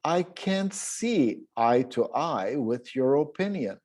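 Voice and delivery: "see" stressed, slow, falling tune; slow model reading. Shown as a demonstration. Teacher models number five for the trainees, slow. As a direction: read it slowly and clearly. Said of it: "Notice how I'm reading".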